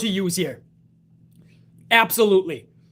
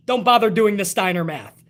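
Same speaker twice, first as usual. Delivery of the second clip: ordinary delivery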